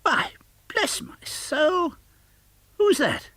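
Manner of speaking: very high, slow astonished exclamation; startled host crying out; surprised cry. Acted. Fred startled to see his uncle at the door, surprised cry. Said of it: "cried Fred".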